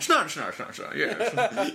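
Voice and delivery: slow, laughing snooty drawl. Acted. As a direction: ham up a stuck-up snob. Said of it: "that's my snooty voice".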